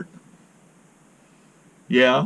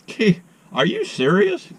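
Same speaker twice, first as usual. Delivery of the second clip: disbelief; 8 semitones higher than usual. Real, not acted. Disbelief at friend stuck on sidewalk.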